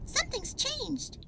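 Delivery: high squeaky little squirrel; young squirrel voice, curious. Acted. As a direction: pitch way up for a small excited squirrel. Pip squirrel character voice.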